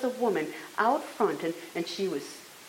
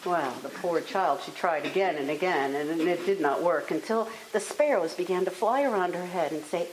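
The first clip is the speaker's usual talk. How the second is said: ordinary delivery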